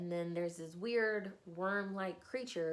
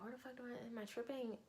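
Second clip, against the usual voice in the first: soft, high-pitched acted disbelief; baffled muttering to himself, pitched up; hushed bewilderment; 6 semitones higher, 7 dB quieter, faster than usual. Man doubting his senses among puppets, hushed bewilderment.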